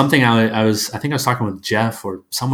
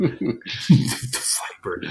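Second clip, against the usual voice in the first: put-on very drawn-out, voiceless G.I. Joe accent; 7 dB quieter, much slower than usual. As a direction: stretch it softly in a G.I. Joe accent. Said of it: "that accent like in G I Joe".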